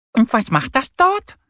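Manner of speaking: high, loud little duck voice; neugierige kleine Ente. A narrator's reading. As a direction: ask it high and curious, as a small duck. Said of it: "will Annie wissen". (hohe, neugierige Entenstimme)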